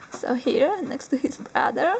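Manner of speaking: usual speaking voice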